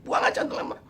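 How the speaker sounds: unvoiced whisper. Narrator secretly tells friend to throw it away, whispering. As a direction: hiss it so the spirit won't hear.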